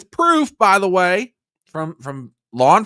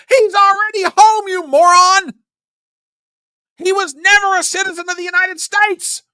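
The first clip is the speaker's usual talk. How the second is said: much higher and louder, scornful; angry yell; 12 semitones higher, 4 dB louder than usual. Real, not acted. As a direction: shout it at the senator in disgust. Yelling insults at senator.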